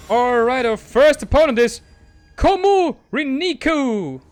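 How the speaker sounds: loud, high match introduction; mock announcer. Streamer presenting the first opponent to viewers, announcer style. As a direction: announce it big, drawing out the name.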